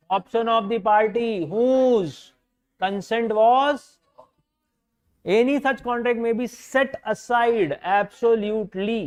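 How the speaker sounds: reading the statute out word by word, very slowly; slow dictation. Shown as a demonstration. Teacher dictating the section to students, slow and deliberate. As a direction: spell out the law slowly so students can write it.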